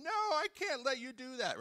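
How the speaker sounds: high-pitched, forceful protest in a put-on voice; bold Peter. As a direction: play brash Peter refusing. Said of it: "Peter was bold in both".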